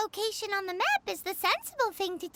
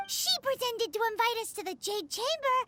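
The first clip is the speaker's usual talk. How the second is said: ordinary talk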